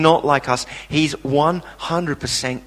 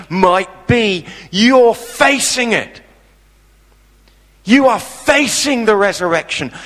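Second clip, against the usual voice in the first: full-force preaching; 6 semitones higher, 5 dB louder than usual. Acted. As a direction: proclaim it boldly from the pulpit.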